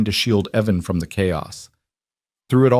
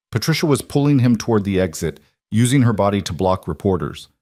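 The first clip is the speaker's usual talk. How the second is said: ordinary delivery, nothing marked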